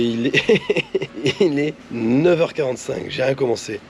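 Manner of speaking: rough, sick voice from a sore throat. Real, not acted. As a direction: speak through swollen tonsils. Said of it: "Ça s'entend à ma voix".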